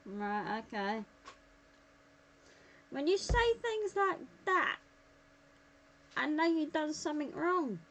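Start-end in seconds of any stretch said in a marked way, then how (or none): 2.9-7.8 accusing. Real, not acted. Accusing partner, raised voice.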